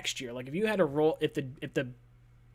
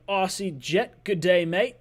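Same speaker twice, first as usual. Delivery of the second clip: mock Australian greeting, a bit higher and louder; Aussie accent; 3 semitones higher, 6 dB louder, slower than usual. Reading a fan's super chat aloud, in a jokey Aussie accent. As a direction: ham up a quick Aussie "good day, mate". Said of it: "you like that little accent too".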